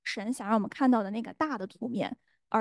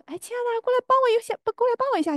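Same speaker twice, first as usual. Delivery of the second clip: much higher, sing-song pleading; sweet coaxing call; 13 semitones higher, faster than usual. Retold or imitated. Wife sweetly calling her husband from the kitchen, high sing-song. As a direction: coax him over sweetly. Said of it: "然后我又说".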